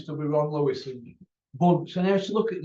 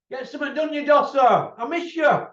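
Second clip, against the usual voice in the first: mock shout; 10 semitones higher, 6 dB louder than usual. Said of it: "I miss shouting out".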